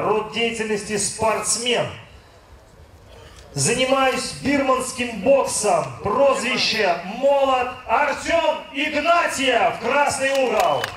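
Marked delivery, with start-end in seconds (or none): 3.5-10.8 slow, higher ring announcer shout; boxing announcer. Acted. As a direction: build to the fighter's name. Announcing fighter's corner.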